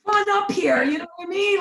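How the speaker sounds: high, loud, near-crying yell; frantic high yell; mock panic. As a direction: yell it like you're hanging upside down. Dangled over the ice.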